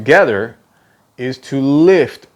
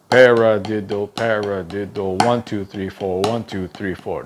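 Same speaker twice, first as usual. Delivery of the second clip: even, lower-pitched monotone chant; rhythmic counting; 4 semitones lower than usual. Shown as a demonstration. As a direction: count it out evenly like a metronome, sinking in volume. Counting out the four separate moves wrongly.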